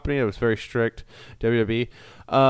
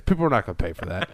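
fast, lightly voiced mock Superhuman; imitating Superhuman; 3 semitones lower, faster than usual. Retelling Superhuman's live video, a quick lightly put-on Superhuman voice. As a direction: rattle it off as a quick dismissive Superhuman impression. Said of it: "This is what Superhuman's saying".

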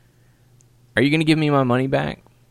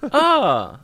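high startled gasps; shocked onlooker crying out; duck voice; 9 semitones higher, 4 dB louder than usual. Pretend. Voicing shocked NPCs.

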